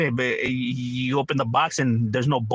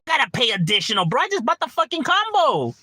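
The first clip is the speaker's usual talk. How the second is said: indignant retort; 8 semitones higher than usual. Retold or imitated. Gamer arguing with the clerk, high and outraged. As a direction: throw the words back at the clerk in outrage.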